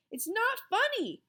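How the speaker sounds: high, loud holler; boy hollering at the teasing classmate, loud. A narrator's reading. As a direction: holler it at him. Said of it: "hollered Ashley".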